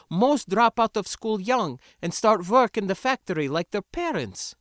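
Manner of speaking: put-on heated, high-pitched accented lecture; scornful foreign rebel. Sindra telling the spacer how workers live, indignant. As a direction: explain it impatiently, voice pitched well up. Accented reply about factory families.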